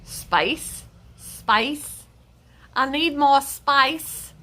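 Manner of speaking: playfully faked Aussie accent; mock Australian accent. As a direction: put on a rough Australian accent.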